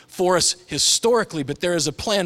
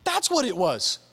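genuine awe; 8 semitones higher, slower than usual. Pretend. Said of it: "genuinely going".

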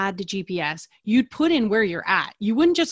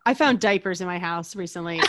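usual speaking voice

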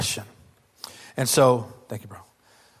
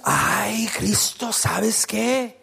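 a put-on gossipy Spanish voice, softer; gossipy dramatic; 3 dB quieter, much slower than usual. Disciples in prison gossiping about Jesus, in a dramatic gossipy voice. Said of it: "all super gossipy and dramatic".

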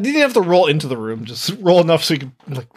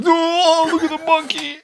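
much higher, gushing surprise; mock amazement; 12 semitones higher, slower than usual. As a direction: gasp at the monkey like a shocked classmate. Classmates react to monkey.

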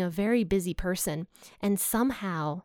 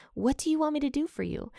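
higher, quicker retold call; 7 semitones higher, faster than usual. Jesus calling to two blind men, lightly calling out. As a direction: pitch it up as if calling across a gap. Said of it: "and he called to them".

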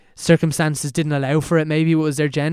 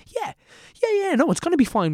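high-pitched, unconvinced "yeah, yeah"; mock self-reassurance; 14 semitones higher than usual. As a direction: imitate kids talking themselves into believing. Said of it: "we all kinda like".

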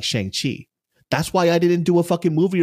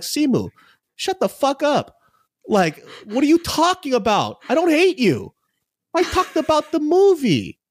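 exasperated retort; 12 semitones higher than usual. Retold or imitated. Retold reply to DM.

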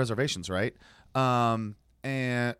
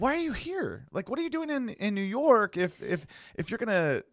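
high-pitched, disbelieving questioning; retold exasperated questioning, much higher in pitch; incredulous challenge; 8 semitones higher than usual. Passenger questioning a driver's views, incredulous.

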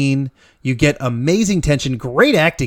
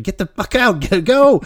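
higher-pitched, half-laughing mock urgency; urgent urging; 7 semitones higher than usual. Viewer urging movie characters to escape the train, mock urgent. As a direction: urge them on as if watching.

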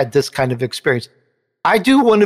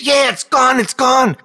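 excited wife; 8 semitones higher than usual. Retold or imitated. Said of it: "She goes".

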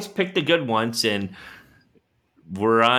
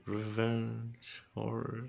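very slow, much softer delivery; drawn out; 2 semitones lower, 19 dB quieter, much slower than usual. Real, not acted. Cohost repeats his revenge horrors suggestion, drawn out and very soft. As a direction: draw each word right out, quietly. Drags out the misunderstood phrase.

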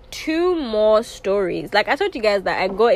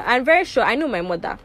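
usual speaking voice

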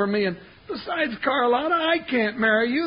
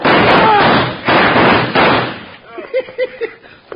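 high, loud wheezing cackle; wheezy high laugh; 9 semitones higher, 8 dB louder than usual. Acted. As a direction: let out a big wheezing laugh. Sidekick's signature laugh.

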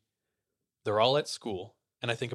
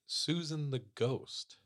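slow, drawn-out, higher-pitched reveal; ominous reveal; 4 semitones higher, much slower than usual. Retold or imitated. Friends reveal the school ghost to a newcomer, slow and ominous. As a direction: stretch it out as an ominous quote.

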